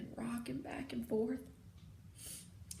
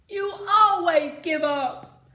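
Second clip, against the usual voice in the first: much louder, higher, slow furious shout; 7 semitones higher, 15 dB louder, slower than usual. Acted. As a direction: hurl the accusation at him. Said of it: "I shouted".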